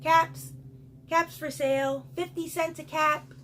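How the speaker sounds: high, loud vendor's cry; calling out. A narrator's reading. Peddler walking back to town, calling out wares. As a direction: hawk your caps to the whole street. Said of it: "walked back to town calling".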